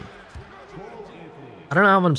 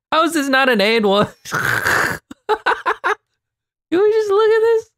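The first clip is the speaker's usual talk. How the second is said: laughing. Real, not acted. Laughing disbelief.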